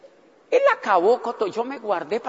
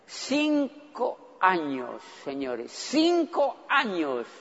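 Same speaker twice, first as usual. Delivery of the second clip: very slow, soft emphasis on cinco; heavy, softer repetition; slow emphatic; 6 dB quieter, much slower than usual. Real, not acted. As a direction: drag out the years for weight. Stressing the years.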